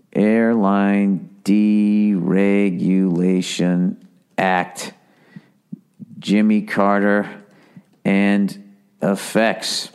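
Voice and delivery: low, slow dictation while typing; slow typed-search murmur; typing aloud. Real, not acted. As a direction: sound out each word as you type it.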